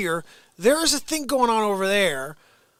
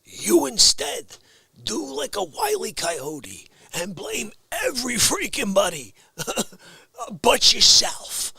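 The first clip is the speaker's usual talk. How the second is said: normal talking voice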